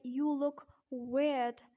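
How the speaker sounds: slow British "weird" with the R dropped; slow British-accent demo. Shown as a demonstration. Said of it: "in British accent".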